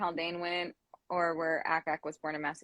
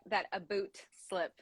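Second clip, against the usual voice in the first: put-on Canadian accent; 5 dB quieter than usual. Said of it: "that was probably a really bad Canadian, uh, impersonation".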